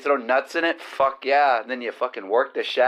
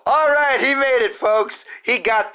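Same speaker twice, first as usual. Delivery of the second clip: excited cheer; 9 semitones higher than usual. Real, not acted. Cheering co-host's success.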